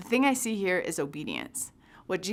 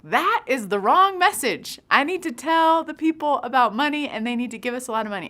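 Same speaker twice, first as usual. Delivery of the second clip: indignant protest; 7 semitones higher, 8 dB louder than usual. Retold or imitated. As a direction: push back, sure you know better. Arguing back with the Lord.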